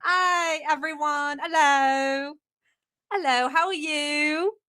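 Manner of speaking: chatty put-on British accent; fake British accent. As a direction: greet followers in a mock British accent. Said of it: "I'm not really British".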